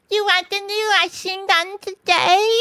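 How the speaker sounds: high, slow put-on polite voice; respectful washerwoman. A narrator's reading. Mole disguised as washerwoman, respectful. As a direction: ask in high mock deference. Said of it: "very respectfully".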